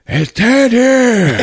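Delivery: high, drawn-out mock shout; hammed-up, high-pitched bellow; mock yell. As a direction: blow the question up into a long holler. Mock repeat of character's question.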